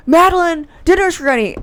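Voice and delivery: high, loud call to dinner; brother calling out. Retold or imitated.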